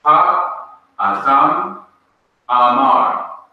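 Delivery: "Ha Adam" then "Amar", slow and clearly spaced; slow, careful Hebrew reading; Hebrew demo. Shown as a demonstration. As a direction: spell out "Ha Adam Amar" slowly for the class. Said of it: "For example".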